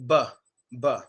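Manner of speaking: B with lips pressed then released; B popped from both lips; B sound. Shown as a demonstration. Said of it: "Next letter is the B".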